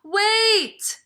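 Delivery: high, loud squeaky cry; squeaky mouse shout. Acted. Mister Mouse warning Ganesha, high loud squeak. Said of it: "squeaked Mister Mouse".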